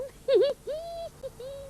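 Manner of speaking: gleeful chuckle. Acted. Sue gloating after the trick.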